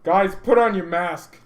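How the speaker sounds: loud, urgent call to the guys. Acted. Fan calling on everyone to mask up, loud and urgent.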